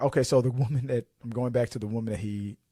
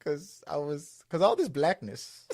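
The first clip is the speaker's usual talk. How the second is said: put-on higher-pitched comic Carpathian accent; mock Carpathian voice, pitched up; Janos; 5 semitones higher, slower than usual. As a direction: imitate the funny foreign-accented creep. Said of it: "That's what he called it".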